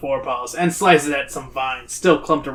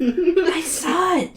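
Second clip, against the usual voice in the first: nearly shout, but keep it small and high; Zoe; 13 semitones higher, much slower than usual. A narrator's reading. Zoe telling teacher of her sighting, nearly shouting. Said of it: "Zoe nearly shouts".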